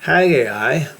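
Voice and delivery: Hebrew name said the American way; American accent. Shown as a demonstration. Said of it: "such is my American accent".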